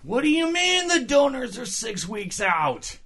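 high, slow outraged doctor; whiny Doctor Klein. Retold or imitated. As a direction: wail it in disbelief. Voicing Doctor Klein again.

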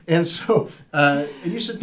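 usual speaking voice

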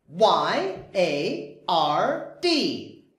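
Y A R D spelled letter by letter, slowly. Shown as a demonstration. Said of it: "spelling out letters".

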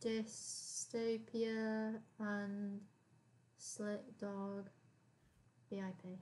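slow, soft and low, word by word; absent-minded note to self, very slow; talking while typing. Real, not acted. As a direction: murmur it drawn out as you write it down.

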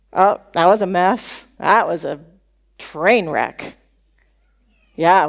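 low-pitched griping congregant; hammed-up complaint in a low register; mock grumbling. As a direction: imitate a disgruntled churchgoer, voice dropped low. Imitating grumbling churchgoers after a glitch.

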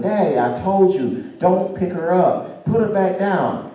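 lightly voiced exasperated daughter; scolding daughter. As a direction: tell Dad off, stretching the words. Daughter scolding her father.

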